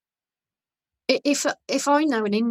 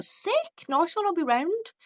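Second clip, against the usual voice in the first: high-pitched, keen retold reply; excited acceptance; 9 semitones higher, slower than usual. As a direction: act out jumping at a friend's offer, voice shooting up.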